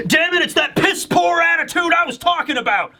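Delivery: high-pitched, hammed-up angry complaint; exasperated outburst. Merchant complaining about the boy's attitude, mock exasperated outburst. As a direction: imitate a fed-up merchant piping up. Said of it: "the merchant then speaks up".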